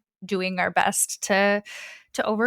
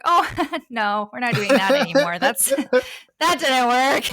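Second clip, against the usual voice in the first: higher-pitched, amused protest; laughing refusal; 4 semitones higher, 4 dB louder than usual. Retold or imitated. Telling the records no to dating again, laughing refusal. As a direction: refuse it through giggles. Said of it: "like, against it".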